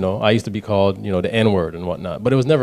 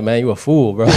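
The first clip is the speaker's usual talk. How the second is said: laughing at himself; 4 semitones higher, 8 dB louder than usual. Real, not acted. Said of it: "I'm like".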